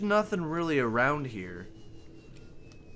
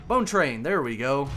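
excited; 7 dB louder than usual. Real, not acted. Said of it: "that's punny".

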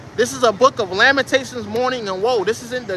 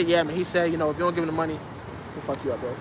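low, soft, couldn't-care-less delivery; nonchalant; 7 semitones lower, 9 dB quieter, faster than usual. Pretend. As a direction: pass on a threat casually, voice dropped way down. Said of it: "You can't deliver the message with nonchalantness".

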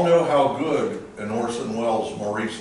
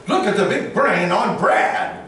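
Samuel L. Jackson line; 7 semitones higher, 5 dB louder than usual. Retold or imitated. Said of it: "Samuel had played".

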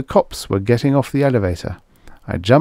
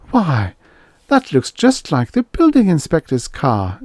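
high innocent surprise, much higher; wide-eyed; 8 semitones higher, 3 dB louder than usual. A narrator's reading. The mayor's wife spotting the inspector's car, high wide-eyed surprise. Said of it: "wide-eyed".

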